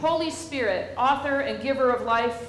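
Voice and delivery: slow, loud, solemn intoning; solemn liturgy. Acted. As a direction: proclaim each phrase slowly to the congregation. Leads funeral liturgy.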